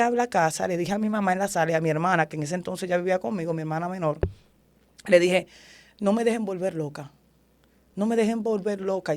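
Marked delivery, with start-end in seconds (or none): none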